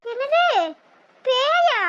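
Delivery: startled. Acted. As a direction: react in alarm to the word ghost. Startled at ghost mention.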